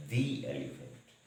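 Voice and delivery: the before elephant said long as "thee", slowly. Shown as a demonstration. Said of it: "इसको पढ़ेंगे". (the को "दी" की तरह धीरे बोलें)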